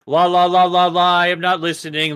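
put-on loud, childish drowning-out chant; mock refusal to listen. Acting out tuning out the American accent, mock childish refusal. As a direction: chant over them as if plugging your ears.

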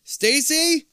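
loud high desperate call; crying out. A narrator's reading. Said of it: "you cry".

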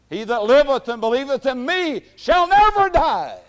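high, ringing shout; Jesus' proclamation. Acted. Preacher voicing Jesus to Martha, high shouted proclamation. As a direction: proclaim it at full force.

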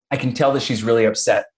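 ordinary talk

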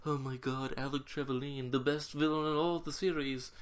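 hushed, geeky mock awe; nerding out. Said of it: "nerding out really hard".

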